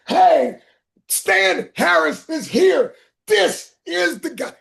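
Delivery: high, loud, slow drawn-out introduction; loud proud announcement. Retold or imitated. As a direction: announce him proudly to the room. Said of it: "this is how loud he is".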